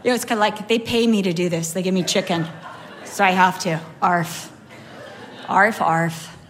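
low, deadpan mock dog voice; jaded Chihuahua. Chihuahua sick of running to the door, jaded. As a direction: voice a fed-up dog, barking half-heartedly. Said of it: "just so over it".

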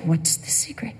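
hushed, conspiratorial whisper. Retold or imitated. Visitors asking about the pastor's secret, whispered. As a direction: lean in and whisper the question.

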